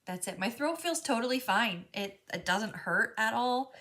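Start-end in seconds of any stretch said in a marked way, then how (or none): none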